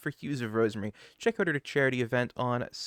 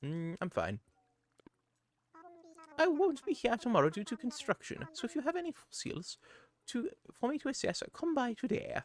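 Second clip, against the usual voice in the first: high, fussy owl curator voice; a chirpy, proper museum owl; Blathers voice; 8 semitones higher than usual. Acted. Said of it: "the fun Blathers voice about assessing your fossils".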